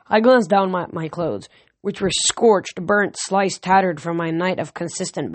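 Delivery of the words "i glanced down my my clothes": ordinary delivery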